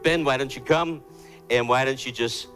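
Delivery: ordinary talk, nothing marked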